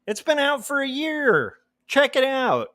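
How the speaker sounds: lightly hammed-up high voice; playful high pitched pitch; high mock voice.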